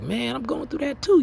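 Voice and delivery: high, excited voicing of a fellow brother; surprised brother. Brother admitting the same struggle, in a high excited voice. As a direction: pitch it way up like you can't believe it. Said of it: "that brother be like".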